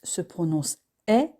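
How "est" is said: "est" as an open è vowel; open E sound. Shown as a demonstration. Teacher demonstrates E circumflex, "est" said as open è. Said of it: "un et ouvert".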